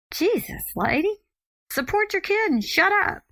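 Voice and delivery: high, fed-up outburst; mock outrage at the nagging ex; exasperated. Mother fed up with ex at basketball game, high exasperated snap.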